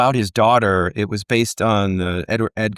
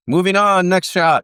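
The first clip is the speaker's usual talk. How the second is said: higher, louder set call; director calling out; 10 semitones higher, 7 dB louder than usual. Retold or imitated. Director moving the crew along, brisk imitated call.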